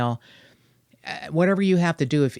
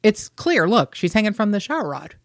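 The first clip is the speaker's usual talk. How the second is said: dismissive cops; 8 semitones higher, 3 dB louder than usual. Retold or imitated. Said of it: "the New York City Police Department is like".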